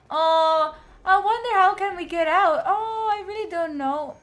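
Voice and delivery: high, loud, thrilled wondering; excited. Real, not acted. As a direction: get swept up in the moment. Excited game moment, high loud.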